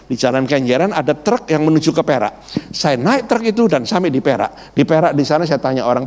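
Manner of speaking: ordinary talk, nothing marked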